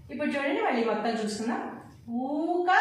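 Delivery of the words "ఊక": stretched ఊ opening ఊక; long vowel. Shown as a demonstration. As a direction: hold the ఊ of ఊక long. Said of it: "దీర్ఘం ఇచ్చి పలకాలి".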